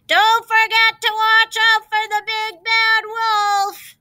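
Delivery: high, loud, slow warning call. A narrator's reading. Mother calling after her departing pigs, high warning call. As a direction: call after them as they leave. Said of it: "called their mother".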